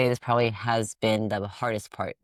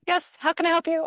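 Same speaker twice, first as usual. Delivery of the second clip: very high, bright customer service voice; 3 dB louder than usual. Retold or imitated. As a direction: chirp it like a cheery call center agent. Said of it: "my customer service voice".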